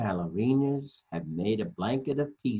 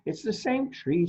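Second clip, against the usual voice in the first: higher, matter-of-fact toy voice; Plastic; 9 semitones higher than usual. Acted. Storyteller voicing Plastic, higher and sure. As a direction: correct them brightly. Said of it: "says Plastic".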